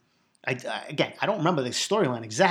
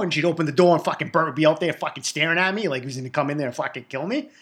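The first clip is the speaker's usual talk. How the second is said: usual speaking voice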